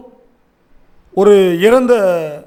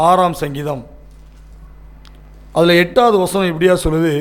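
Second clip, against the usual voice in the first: ordinary talk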